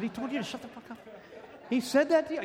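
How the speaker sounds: a buddy gasping it back through a laugh; incredulous friend. Retold or imitated. Friend can't believe the old timer said it, laughing incredulously. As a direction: pitch jumps way up, half laughing in disbelief. Friend reacts to shut-up story.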